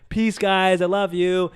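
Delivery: high, loud cheery farewell; hammed-up farewell to the band; cheery goodbye. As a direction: sing out a big happy goodbye. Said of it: "and I say".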